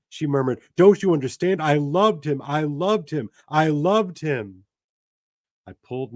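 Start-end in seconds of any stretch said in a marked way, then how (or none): 0.8-4.7 high, pained repeated confession; anguished confession. Acted. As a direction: let it break with love. Intended's outburst.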